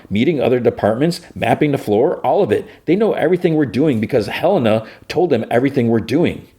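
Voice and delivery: high, agitated rant; heated outburst. Retold or imitated. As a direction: push it up in frustration. Mark's heated outburst retold.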